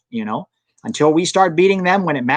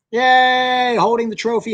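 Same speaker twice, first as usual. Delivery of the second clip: mock cheer; 9 semitones higher, slower than usual.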